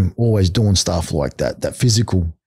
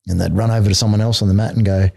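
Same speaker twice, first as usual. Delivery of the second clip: ordinary delivery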